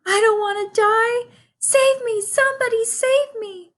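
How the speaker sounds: very high, loud squeaky piglet begging; a terrified pig crying out for help; panicked pleading. A narrator's reading. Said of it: "screamed Wilbur".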